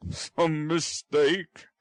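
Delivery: very slow, thoughtful; puzzled and half to himself; musing. Acted. Brownlow muses over the mix-up, slowly.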